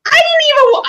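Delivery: very high, loud mimicry; mocking brother's wife. Imitating brother's wife to co-host, high mocking voice. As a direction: imitate her in a squealing high pitch. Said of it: "my brother's wife's like".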